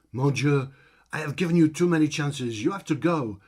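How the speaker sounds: loud, high, exaggerated French accent; dreadful French accent. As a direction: bellow it like a pompous French ringmaster. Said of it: "in that dreadful French accent".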